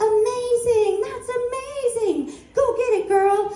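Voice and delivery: high, squealing rave of delight; gushing friend. Retold or imitated. Best friend cheering her on, high and gushing. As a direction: gush like an overjoyed best friend. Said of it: "And he's like".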